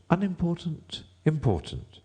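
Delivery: high-pitched testing of each word; trying out words. A narrator's reading. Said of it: "trying which word sounded best".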